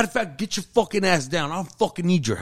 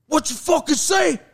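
high, loud, bellowed challenge; furious shout; 12 semitones higher, 3 dB louder than usual. Retold or imitated. Sergeant confronting a mouthy soldier, furious shout. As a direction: roar it right in the soldier's face.

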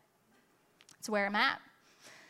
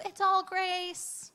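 mock all-grace voice; 7 semitones higher, much slower than usual. Mocking the all-grace message.

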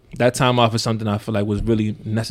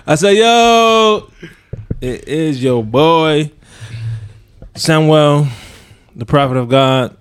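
slow, loud, drawn-out preacher announcement; preacher intro voice; 5 semitones higher, 6 dB louder, much slower than usual. Pretend. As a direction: proclaim it like a revival preacher. Mock grand self-introduction.

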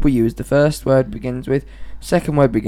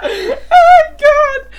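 very high, loud, slow gasped words; shrieking laughter; 5 dB louder, much slower than usual. Real, not acted. Girlfriend cracking up at boyfriend's accent, shrieking laughter. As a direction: shriek with laughter, then gasp it out.

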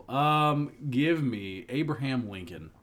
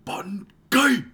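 sudden high, loud yell; explosive high-pitched holler; mock battle cry; 12 semitones higher, 14 dB louder than usual. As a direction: shout it like a charging war cry.

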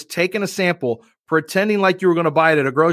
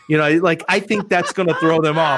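ordinary talk, nothing marked

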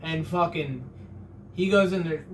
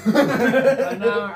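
laughing; 6 semitones higher, 8 dB louder, slower than usual. Real, not acted. Repeats through laughter.

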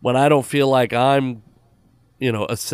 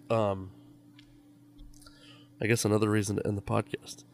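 ordinary delivery

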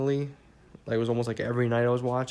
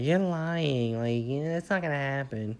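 put-on higher, squeaky kid scoffing; young kid voice; 3 semitones higher, slower than usual. Kid self doubts the future news, higher scoffing voice. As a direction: play your disbelieving eight-year-old self. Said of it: "the eight-year-old me would say".

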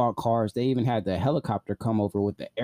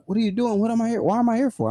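higher, bewildered questions; confused protest; 12 semitones higher, 4 dB louder, faster than usual. Retold or imitated. Strapped into the chair, higher confused protest. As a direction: play the disbelief of being dragged off.

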